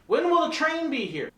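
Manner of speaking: high-pitched clueless tourist voice; dumb tourist. Acted. Tourist asking train worker about schedule, high clueless voice. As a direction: ask it high and naive. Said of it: "my dumb tourist".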